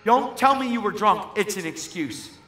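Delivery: loud, high courtroom rebuke; acted-out judge laying down the law; angry judge scolding. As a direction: cut off his excuse at full voice. Acts out judge scolding the uncle.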